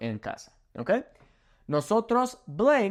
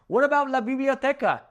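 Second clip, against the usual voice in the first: laughing; 10 semitones higher than usual. Real, not acted. Amused, laughing at own joke.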